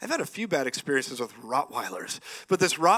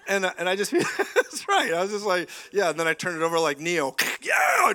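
laughing; 3 semitones higher than usual. Real, not acted. Laughing at own story.

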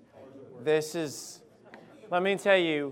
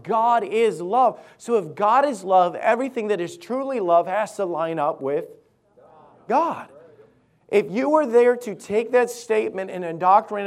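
raised pulpit voice, key words stretched; emphatic preaching; 4 semitones higher, 5 dB louder than usual. Real, not acted. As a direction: proclaim it to the congregation with force.

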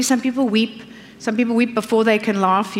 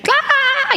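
mock child scream. Child freaking out.

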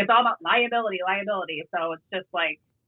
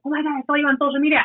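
quick, higher-pitched fan gush; excited recognition; 6 semitones higher, much faster than usual. Acted. Bystander mid-argument recognizing the officer, excited and quick. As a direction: imitate someone thrilled to spot a familiar face.

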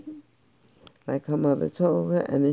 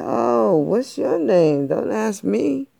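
loud, higher sassy brush-off; sassy girl; 5 semitones higher, 5 dB louder than usual. A narrator's reading. Little girl sassing the cool cat. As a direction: toss it back with attitude.

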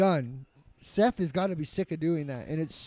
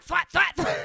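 mock flapping noise; 10 semitones higher than usual. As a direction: mimic a slapping sound, mocking. Mocking the sound of thrusting.

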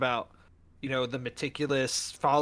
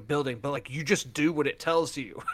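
ordinary delivery

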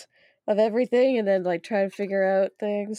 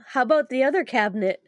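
usual speaking voice

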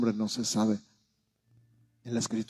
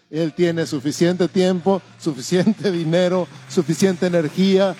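rising preaching; 6 semitones higher, 6 dB louder than usual. Acted. Preacher builds to climax.

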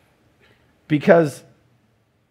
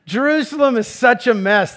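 higher and louder, exasperated at the city; exasperated sigh of a line; 11 semitones higher, 7 dB louder than usual. Retold or imitated. Preacher voicing Jesus fed up with Jerusalem, loud exasperation. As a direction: throw up your hands at Jerusalem. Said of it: "he's just going".

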